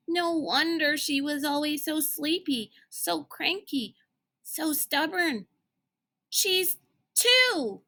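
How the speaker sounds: high, incredulous rising voice; a boy's astonished discovery; amazed realization. Acted. Sticky's amazed realization about Constance.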